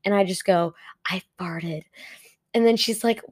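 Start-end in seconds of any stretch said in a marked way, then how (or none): 1.1-1.8 quietly owning up to a coworker; hushed confession. Retold or imitated. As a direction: drop the volume right down to confess. Confessing secretly to favorite coworker.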